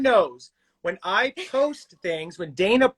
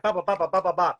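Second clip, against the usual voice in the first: rapid-fire "ba ba ba" syllables; fast patter; 3 semitones lower, much faster than usual. Retold or imitated. Host shows how fast shooting goes, rapid patter. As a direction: rattle off nonsense syllables quickly. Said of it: "it goes so fast".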